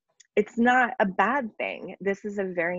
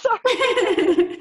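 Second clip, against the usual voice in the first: laughing; 6 dB louder than usual. Real, not acted. Laughing after losing the question.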